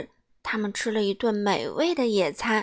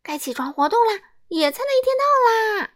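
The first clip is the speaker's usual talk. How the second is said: much higher and louder; excited loud call; loud wake-up call; 12 semitones higher, 5 dB louder than usual. Acted. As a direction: rouse the sleeper. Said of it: "他喊道".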